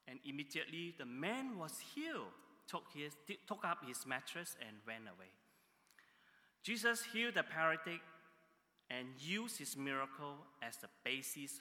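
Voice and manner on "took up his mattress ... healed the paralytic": normal talking voice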